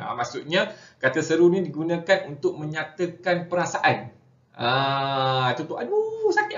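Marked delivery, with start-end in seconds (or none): 5.8-6.6 high-pitched demo cry of pain; mengaduh kesakitan. Shown as a demonstration. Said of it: "perasaan dia sedang kesakitan". (lakonan mengaduh sakit, nada tinggi)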